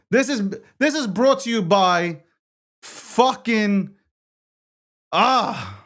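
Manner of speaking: high, hammed-up ad-read voice; mock announcer. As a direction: pretend the sponsor line, then give up.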